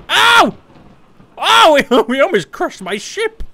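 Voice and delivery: high, loud yelp then laughing; startled yelp into laughter. Real, not acted. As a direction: cry out as the ship nearly crashes. Near crash, laughing.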